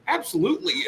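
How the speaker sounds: ditzy high yes; high, bright "absolutely". Retold or imitated. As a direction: squeak it out gleefully. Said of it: "she's like".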